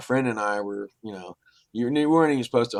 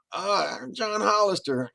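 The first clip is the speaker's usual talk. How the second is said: much higher voice for the friendly stranger; imitating John; 11 semitones higher than usual. Stranger introduces himself to scared hunters, voiced much higher. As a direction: pop the name out brightly, pitched way up.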